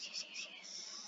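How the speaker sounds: tiny unvoiced whisper of glee; whispered celebration. Real, not acted. Player celebrating new joiners to self, whispered. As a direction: whisper it rapidly to yourself. Quiet celebration of people joining.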